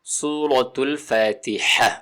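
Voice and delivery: slow, measured tajweed reading of الفاتحہ; careful tajweed. Shown as a demonstration. Teacher naming the surah for learners, careful tajweed. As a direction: say سورۃ الفاتحہ syllable by syllable, with a clear breathy ح. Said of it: "اس کا نام ہے".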